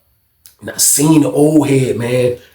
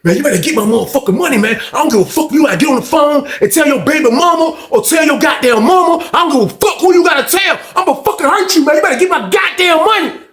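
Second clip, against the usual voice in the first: furious threat; 11 semitones higher than usual. Retold or imitated. Threatening over money.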